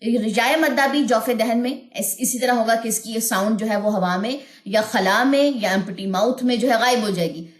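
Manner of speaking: ordinary delivery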